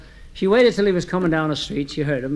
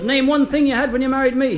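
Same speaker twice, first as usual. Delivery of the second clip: high-pitched acted challenge; challenging wife; 8 semitones higher than usual. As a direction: dare the husband to answer, pitched high. Wife's acted quarrel with husband.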